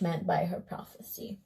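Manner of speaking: the king's line redone in a whisper; ominous whispered ending; whispered retake. Acted. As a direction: hush it to a breathy whisper. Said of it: "what that old witch meant by".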